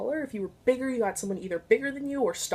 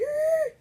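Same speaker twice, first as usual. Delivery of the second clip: sharp high yelp. Retold or imitated. Mock drowning victim gasp.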